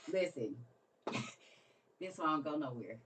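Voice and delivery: ordinary talk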